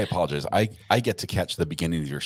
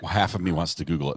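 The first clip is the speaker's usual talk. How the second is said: ordinary talk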